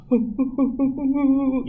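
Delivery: high mock sobs in a quick string; imitated sobbing. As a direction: mimic a weeping worshipper, comically. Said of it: "Samping kanan saya nangis". (menirukan isak tangis)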